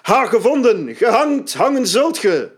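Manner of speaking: high, loud battle roar; primal roar. A narrator's reading. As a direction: roar it out in triumph. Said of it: "haalt een oerkreet me in".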